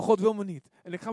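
ordinary talk

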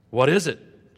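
loud alarmed cry; shout. Acted. Jonah inside the storm crying out, loud shout. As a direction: call out in alarm. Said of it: "Jonah shouted out loudly".

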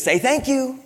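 put-on high child voice parroting "Say thank you"; son imitation. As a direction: chirp it back like the literal kid.

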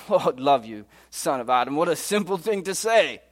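laughing British Mister Beaver; laugh, then exclaim with British-accented amusement; amused British storybook character, rising at the end; great laugh. Acted. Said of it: "answered Mister Beaver with a great laugh".

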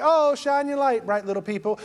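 sugary Ephesians voice. Retold or imitated. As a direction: gush sweetly like a greeting card. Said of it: "And Ephesians is like".

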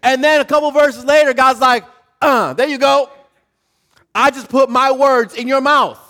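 loud and high, driving the verse home; fiery sermon delivery; full-force preaching. Acted. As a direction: preach it big over the music.

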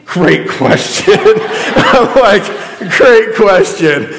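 slow, amused, caught out; chuckling great question; sheepish laugh. Retold or imitated. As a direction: laugh at being stumped. Athlete asks what the process is.

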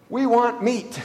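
mock complaining Israelites; whining crowd.

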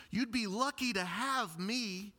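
high, cocky boast; smug young man. Retold or imitated. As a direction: brag to Jesus like a spoiled kid. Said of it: "like he's saying to Jesus".

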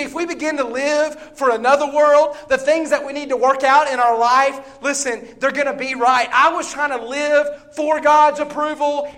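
loud, high, building preaching. Acted. Preacher urging church to live for another world, rising preaching. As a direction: climb back to full force.

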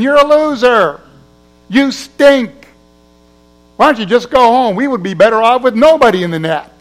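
hammed-up playground taunt; mocking kids. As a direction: taunt the goalie like a cruel kid.

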